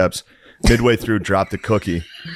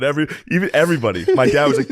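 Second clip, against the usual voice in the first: ordinary talk, nothing marked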